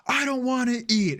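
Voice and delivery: mock whine, pitched high; petulant refusal. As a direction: imitate a sulky husband refusing. Acted quarrel, husband's reply.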